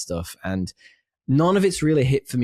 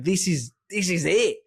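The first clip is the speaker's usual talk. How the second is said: put-on kid's excitement; 9 semitones higher than usual. Child gets the T-Rex toy.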